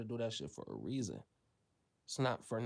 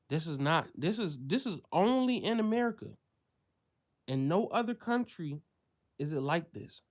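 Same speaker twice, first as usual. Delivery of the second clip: higher and louder, pressing hard on only; heated rant; 5 semitones higher, 5 dB louder than usual. Real, not acted. Ranting to viewers about America, loud and heated. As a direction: raise the voice in outrage.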